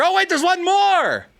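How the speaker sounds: high, drawn-out showman's reveal; mock surprise. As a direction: feign a sudden discovery, stretching it out high.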